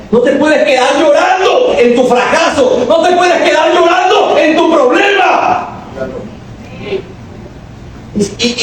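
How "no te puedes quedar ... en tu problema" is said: high, slow, rising to a shout; slow preacher's shout. Acted. Preacher telling the church not to stay weeping, slow and high. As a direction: hammer it home slowly.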